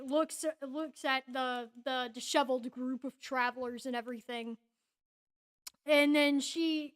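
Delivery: normal talking voice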